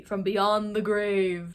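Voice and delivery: slow, drawn-out spooky joke voice; cabin guy ghost. Retold or imitated. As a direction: stretch it out like a mock ghost. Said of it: "jokes about it being cabin guy".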